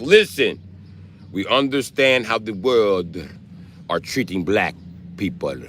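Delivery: slow mock Russian accent; slow, heavy Call of Duty Russian accent; Vladimir Putin. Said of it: "My Russian accent came from Call of Duty".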